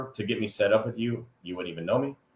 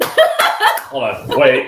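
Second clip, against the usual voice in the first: laughing; 8 dB louder, much slower than usual. Real, not acted. Guest walks in, host cracks up.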